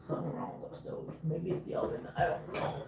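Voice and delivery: soft, voiceless under-the-breath worry; whispered mutter. Real, not acted. Cook puzzling over the stove to herself, whispering. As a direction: whisper it to yourself, away from the mic.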